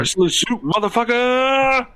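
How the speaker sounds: high, loud holler with a long drawn-out ending; hype-man yell, rising and held; bellowed catchphrase. Pretend. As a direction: shout it like a show's battle cry, stretching the last word.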